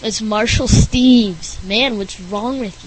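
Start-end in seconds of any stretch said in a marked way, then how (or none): 0.0-1.6 "Marshall Steves" slowly, clearly as MAR-shul STEEVZ; correct pronunciation. Shown as a demonstration. Settling the name once more, slow and deliberate. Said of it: "We say it like".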